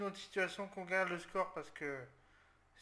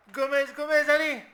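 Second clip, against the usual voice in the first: much higher, much louder cheering; excited yelling; 8 semitones higher, 15 dB louder than usual. Real, not acted. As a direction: yell him on toward goal. Occasion de but, il crie. (hurle d'excitation, beaucoup plus aigu et fort)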